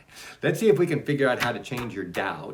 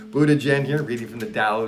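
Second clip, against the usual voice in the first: host intro; 4 semitones higher, 10 dB louder than usual. Acted.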